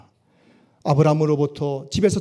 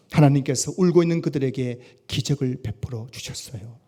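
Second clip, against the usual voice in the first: normal talking voice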